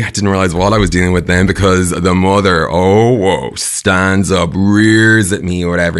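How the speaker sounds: normal talking voice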